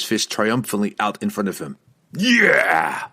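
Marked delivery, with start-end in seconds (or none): 2.2-3.1 high, loud dwarf whoop; gleeful scream. A narrator's reading. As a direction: scream it out in battle-happy glee. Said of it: "he screamed in glee".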